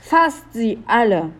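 slow, high, emphatic orders; urgent command. A narrator's reading. Leo orders guards to seize intruders, commanding.

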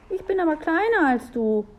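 put-on high, timid child voice; small boy, high and anxious; anxious little boy. A narrator's reading. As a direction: plead as the smaller brother. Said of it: "sah sie ängstlich an".

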